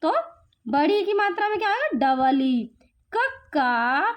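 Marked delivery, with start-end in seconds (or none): 3.1-4.1 क and का stretched out very slowly; slow syllable drill. Shown as a demonstration. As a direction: draw out each syllable for a child. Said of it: "spelling syllables for children".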